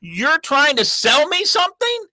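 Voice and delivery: high, sharp retort; mock outrage at the phone rep; angry retort. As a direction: snap back at the salesman. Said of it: "And I'm like".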